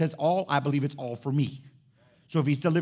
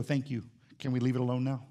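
gentle God voice; 6 semitones lower, 4 dB quieter than usual. Pretend.

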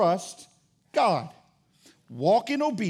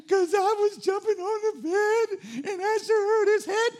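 crying daughter; 10 semitones higher than usual. Retold or imitated. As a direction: admit it through exaggerated little-girl tears. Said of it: "What are you crying about?".

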